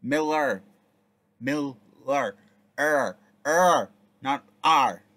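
Miller with a hard final er, loud and clear; stressing the ending. Shown as a demonstration. Correcting her own reading, stressing the ending. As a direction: hammer the er sound in Miller. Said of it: "Did I really just say [name]".

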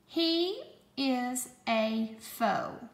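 slow, word-by-word dictation; slow, clear dictation voice; dictation pace. Shown as a demonstration. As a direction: read it out slowly for spelling. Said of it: "four words".

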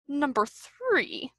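slow, soft, frowning grumble; displeased mutter. A narrator's reading. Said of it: "muttered Owl, frowning".

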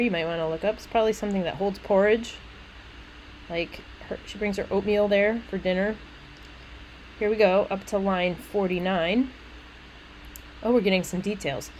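ordinary talk, nothing marked

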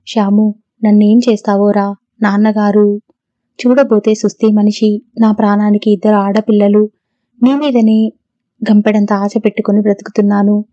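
ordinary delivery, nothing marked

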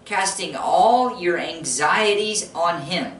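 slow, weighty reading; slow deliberate reading; slow scripture read. Shown as a demonstration. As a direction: lean on each word of the verse.

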